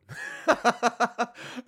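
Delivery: high giggling laugh; breathy high laugh. Real, not acted. Host laughing at his own question to the audience.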